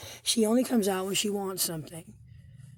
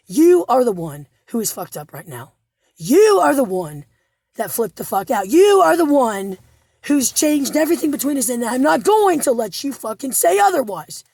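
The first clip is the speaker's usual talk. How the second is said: retold furious shouting; 3 semitones higher, 7 dB louder than usual. Woman reliving the fight, shouting blame at her partner, building fury. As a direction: hammer every "You are the one" harder. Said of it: "I said".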